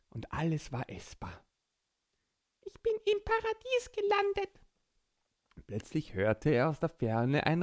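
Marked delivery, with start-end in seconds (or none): 2.6-4.6 high squeaky little creature voice; small child voice. Acted. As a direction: speak like a tiny excited child. Borki delighted.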